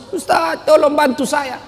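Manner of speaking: high, mock-weeping call for help; imitating a tearful woman; imitated crying plea.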